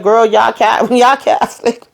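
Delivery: mock retort, cracking up mid-word; laughing comeback. Voicing the doctor's comeback, laughing.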